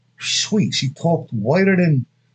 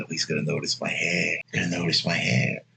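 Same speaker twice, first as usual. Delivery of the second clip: inner thought; 9 semitones lower, 8 dB quieter than usual. Retold or imitated. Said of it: "she's thinking".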